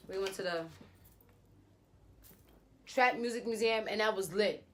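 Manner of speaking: usual speaking voice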